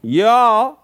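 high, loud Southern twang rising at the end; big country Southern twang; Southern accent. Shown as a demonstration. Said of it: "that's where the southern accent is".

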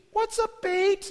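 put-on high-pitched voice of a puzzled kid. Kids ask counselor what bait is, puzzled high kid voice. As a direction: squeak it like a clueless child. Kids question him in retold story.

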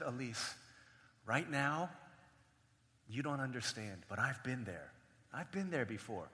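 soft, low, with long pauses; quietly reassure, as one who knows; gentle consoling. Acted. Big brother comforting crying little sister at school, gentle consoling.

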